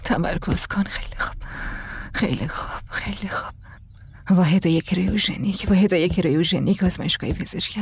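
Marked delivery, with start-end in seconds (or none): 0.0-3.5 hushed whisper, self-soothing. Acted. Alone in the sealed pod, whispering herself calm. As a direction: whisper it over and over to stay calm.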